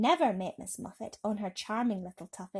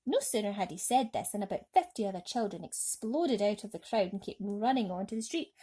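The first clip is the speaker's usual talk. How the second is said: plain reading voice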